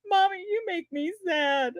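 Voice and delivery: put-on high, slow tearful little-kid voice; toddler pout. Toddler telling mommy he is sad, high whimper. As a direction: imitate a sulky crying toddler. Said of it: "he goes".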